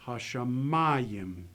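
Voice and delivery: Hashamayim, slow, weight on the ma of mem; stress on the mem. Shown as a demonstration. Teacher models stress on mem for learners, slow. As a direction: drag out Hashamayim, leaning on the mem. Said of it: "the accent is gonna go with the mem".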